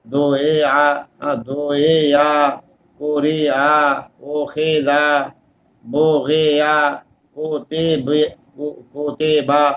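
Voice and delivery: each syllable split apart, slowly; broken, slow reading like دو اے یا. Shown as a demonstration. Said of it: "تو غلط کیسے ہو سکتا ہے".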